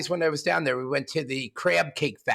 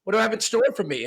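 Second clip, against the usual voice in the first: eager Biff; 8 semitones higher, 3 dB louder than usual. Acted. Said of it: "He said".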